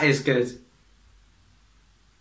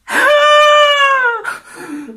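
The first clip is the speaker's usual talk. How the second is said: high, loud, shrieking burst of laughter; 8 dB louder than usual. Real, not acted. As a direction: scream with laughter. Explodes laughing at clip.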